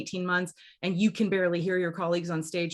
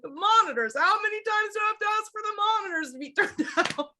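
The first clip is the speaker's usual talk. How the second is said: much higher, laughing through it; tearful outburst; 13 semitones higher than usual. Retold or imitated. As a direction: blurt it out, half crying, half laughing. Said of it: "And I'm like".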